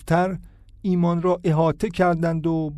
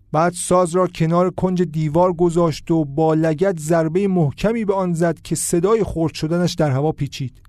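ordinary delivery, nothing marked

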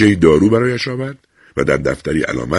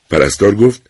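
usual speaking voice

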